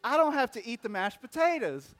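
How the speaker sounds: a lightly voiced gloating little boy; whiny kid. Child voiced refusing food.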